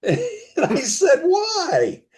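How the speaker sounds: a laughing, squeaky high why; real laughter breaking into the question. Real, not acted. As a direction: laugh it out in disbelief. Laughing at the warning.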